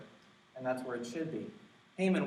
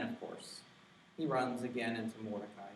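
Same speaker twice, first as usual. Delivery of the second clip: ordinary talk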